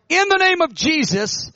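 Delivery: high, slow ringing command. Retold or imitated. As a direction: proclaim it over the crowd. Commanding healing over the crowd.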